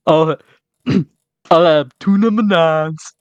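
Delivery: slow, put-on ordering voice; Morgan impression. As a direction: order slowly in character. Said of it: "Morgan's more like".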